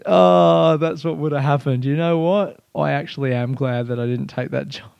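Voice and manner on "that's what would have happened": ordinary delivery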